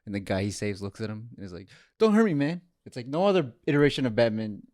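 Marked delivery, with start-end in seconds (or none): 2.0-2.6 scared plea. Retold or imitated. Rescued man pleads with Batman.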